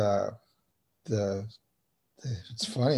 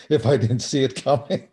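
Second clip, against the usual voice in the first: chuckling through the punchline; laughing; 2 semitones higher than usual. Real, not acted. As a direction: laugh it off at your own joke. Laughing at own joke.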